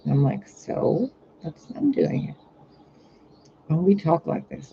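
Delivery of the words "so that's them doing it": higher-pitched bratty comeback, trailing soft; bratty retort. Retold or imitated. Bickering with the trickster like family, bratty retort. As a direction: toss it back like a bickering kid. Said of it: "like these two bratty kids".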